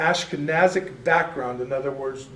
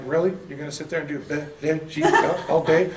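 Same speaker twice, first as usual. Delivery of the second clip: incredulous quote broken by laughter; laughing mock disbelief. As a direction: tease himself through a laugh. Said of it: "where he's like".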